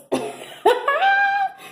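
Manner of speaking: squealing laugh; high burst of laughter. Real, not acted. Laughing at own act.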